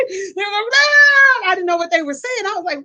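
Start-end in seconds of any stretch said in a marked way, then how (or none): 0.7-1.5 long, high mock scream, loud; comic retold shriek, held long; imitated scream. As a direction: wail out a drawn "aah" like alarmed onlookers. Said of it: "They were screaming".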